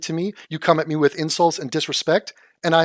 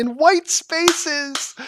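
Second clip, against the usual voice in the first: high, drawn-out mocking repeat of her words; mocking echo; 12 semitones higher, 3 dB louder, much slower than usual. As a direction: parrot her phrase back in a shrill sneer. Mocking her phrase back.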